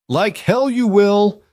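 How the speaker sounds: high, loud and slow, hurled at him; a mother's furious defiance; explosive outburst. Acted. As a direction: explode with the words to make everyone flinch. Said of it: "The words exploded from Nora with such force".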